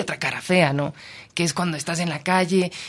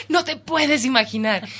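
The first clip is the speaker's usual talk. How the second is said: high laughing, slower; 9 semitones higher, 4 dB louder than usual. Real, not acted. Reporter laughing to the host, slow high laugh. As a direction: laugh through the words. Laughing at her own emotion.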